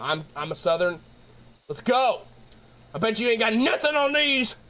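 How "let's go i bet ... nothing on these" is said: higher, pumped-up mock brawler boast; mock tough challenge. Southerner challenging Northerners in chat, joking higher tough-guy boast. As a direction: puff up like a brawler, all in fun.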